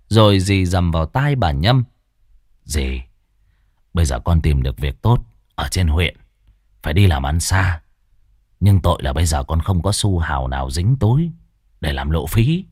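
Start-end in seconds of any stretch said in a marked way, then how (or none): 2.6-12.7 low wheedling. Acted. Nephew wheedling for money.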